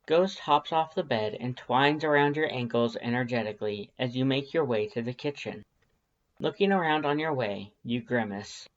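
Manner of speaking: ordinary delivery, nothing marked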